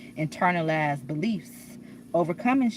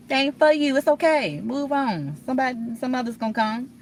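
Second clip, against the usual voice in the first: higher and louder comforting reassurance; consoling; 7 semitones higher, 4 dB louder than usual. Pretend. Reassuring her younger self to move on, higher and louder. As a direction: soothe a hurt younger self.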